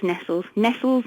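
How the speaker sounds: Nestles said as NESS-ulls; old British pronunciation. Shown as a demonstration. Guest agreeing on the childhood name, NESS-ulls for Nestles. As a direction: rhyme Nestles with "wrestles". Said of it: "Yeah, it was".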